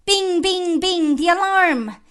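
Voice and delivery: high, loud imitation of clanging bells; ringing alarm cry. Reciter sounds the fire alarm, high ringing cry. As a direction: ring each "bing" out like a fire bell.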